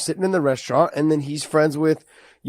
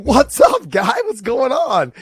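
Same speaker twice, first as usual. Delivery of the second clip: excited greeting; 7 semitones higher, slower than usual. Retold or imitated. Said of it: "And I was like".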